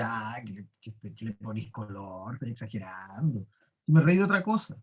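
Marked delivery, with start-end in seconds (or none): 0.0-3.5 much softer, low, offhand mumble; dismissive brush-off. Retold or imitated. Teacher voicing a coworker's reply, dismissively soft. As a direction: wave it away like it's nothing. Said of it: "la respuesta puede ser desde".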